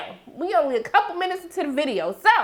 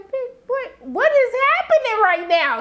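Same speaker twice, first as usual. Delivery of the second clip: shocked disbelief; 11 semitones higher than usual. Retold or imitated. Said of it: "I said".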